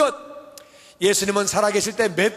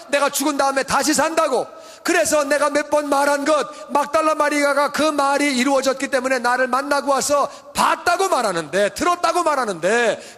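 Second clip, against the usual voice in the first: fervent preaching; 4 semitones higher than usual. Acted. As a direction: preach it with full conviction. Preaching at full force. (힘찬 설교조)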